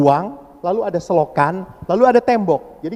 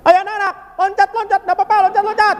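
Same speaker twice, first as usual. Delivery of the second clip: much higher, excited encouragement; cheerful urging; faster than usual. Retold or imitated. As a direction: egg the kids on joyfully. Priest urging kids cheerfully.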